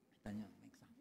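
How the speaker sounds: breathy whispered side remark; whispered aside. Real, not acted. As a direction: lean in and whisper it to the person beside you.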